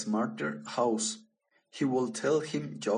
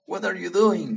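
loud, high what are you doing; a trapped lion startled by the mouse, loud and high; 7 semitones higher, 8 dB louder than usual. Acted. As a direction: blurt it out in alarm.

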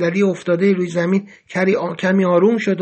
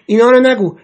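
sharp and loud, pitched higher; angry; 4 semitones higher, 7 dB louder than usual. A narrator's reading. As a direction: snap it out in anger. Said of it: "پریتسا با عصبانیت گفت".

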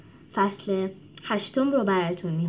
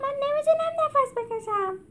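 high, strained voice gasping for air; breathless creature. A narrator's reading. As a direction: squeeze it out like you can't breathe. Animals crammed in cage.

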